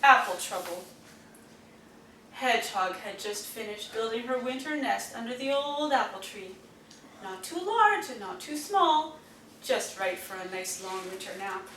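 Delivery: plain reading voice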